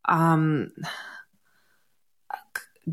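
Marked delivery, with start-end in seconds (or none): none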